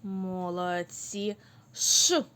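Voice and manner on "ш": "Ш" as a loud, long hush; "Ш" said clearly on its own, pushed out loud; isolated sound demo. Shown as a demonstration. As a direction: sustain "Ш" like shushing a room. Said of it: "слово на звук Ш".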